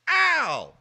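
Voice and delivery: loud, high cry of pain; sudden yelp. Retold or imitated. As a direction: yelp as the pellet hits. Mom hit by a BB.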